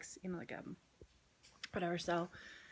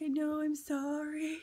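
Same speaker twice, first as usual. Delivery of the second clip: higher, slow, lightly voiced teary kid; imitating tearful son; 7 semitones higher than usual. Mom imitating her son apologizing, a light teary kid voice. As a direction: play the sorry kid close to tears. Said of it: "He's like".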